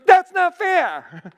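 put-on high, slow, drawn-out childish whine; whiny little brother. Younger brother complaining to his older brother, whining. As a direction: whine it like a kid denied a treat. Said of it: "The younger brother goes".

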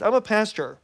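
ordinary talk, nothing marked

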